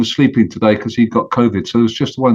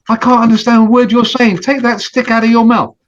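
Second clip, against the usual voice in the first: higher, exasperated turtle; can't make out a word, say so higher; annoyed turtle; 8 semitones higher, 8 dB louder than usual. Acted. Turtle tells goose to drop the stick, higher and annoyed.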